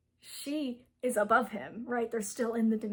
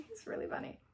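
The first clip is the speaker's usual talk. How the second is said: "He's really funny" whispered through a giggle, much higher; laughing whisper; 10 semitones higher, 9 dB quieter, faster than usual. Real, not acted. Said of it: "Alaric is really funny".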